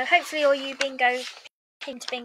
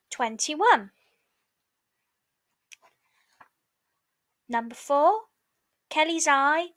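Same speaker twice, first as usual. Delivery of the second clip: raised, sing-song bingo calling; bingo caller; 2 semitones higher than usual. Acted. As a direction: call it out like a bingo hall caller. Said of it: "bingo calls".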